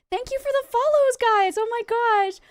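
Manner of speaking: high, excited thanks; overwhelmed gratitude. Real, not acted. Streamer thanking new followers, excited and overwhelmed. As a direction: gush with real astonishment.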